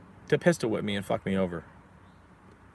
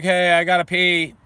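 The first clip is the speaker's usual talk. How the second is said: louder, higher and slow call; 7 semitones higher, 7 dB louder, slower than usual. Retold or imitated. Locked in the interrogation room calling to cops, slow loud call. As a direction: call through the door to get attention.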